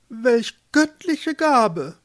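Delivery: high, slow, exalted declaration; grand lady pope. A narrator's reading. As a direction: savour it with lofty awe. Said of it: "befand Päpstin Ilsebill". (salbungsvolle Päpstin, hoch und gedehnt)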